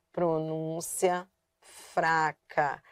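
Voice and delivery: soft, low and drawn out; weak pronunciation. Shown as a demonstration. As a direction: let it go weak, very slowly. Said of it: "Átono é aquele que tem pronúncia fraca".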